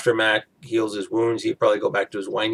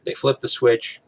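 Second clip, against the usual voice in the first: ordinary talk, nothing marked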